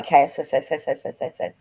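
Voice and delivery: long hissing s sound, laughing; drawn-out s like a snake. Acted. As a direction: stretch the S into a hiss. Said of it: "Isn't that right".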